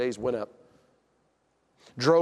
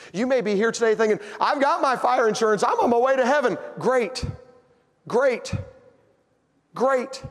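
higher, louder mock confidence; preaching at full force; 8 semitones higher, 6 dB louder than usual. Preacher to listeners sure of heaven, loud preaching. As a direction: preach their smugness back at them.

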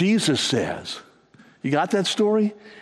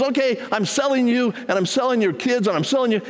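higher, acted-out threat from a master; mock-stern master, pitched up; retold stern threat; 8 semitones higher than usual. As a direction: lay down the punishment as the master. Master threatens servant in retold parable.

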